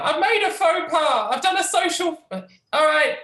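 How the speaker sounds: high-pitched sheepish crier voice; embarrassed crier. Acted. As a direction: squeak out the faux pas. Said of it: "I was like".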